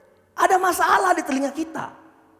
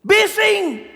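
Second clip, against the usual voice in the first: normal talking voice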